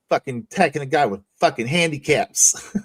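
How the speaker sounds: ordinary delivery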